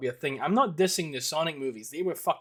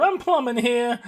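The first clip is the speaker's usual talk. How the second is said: put-on Italian plumber accent; 9 semitones higher, 8 dB louder, slower than usual. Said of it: "Plumber Italian".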